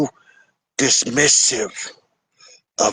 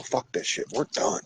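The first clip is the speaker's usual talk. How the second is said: whispered final words, much softer; quiet fed-up; 10 dB quieter than usual. Retold or imitated. Partner walking out, whispered fed-up parting. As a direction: breathe it out under the breath. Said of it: "and they go".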